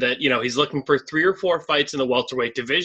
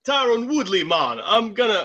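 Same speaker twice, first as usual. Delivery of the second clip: Nigerian impression; 7 semitones higher than usual. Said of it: "Just fucking play along".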